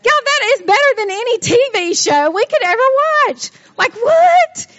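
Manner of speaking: real high-pitched giddy amazement; amazed, laughing. Real, not acted. Teacher marvelling at the Bible story with her class, giddy and amazed.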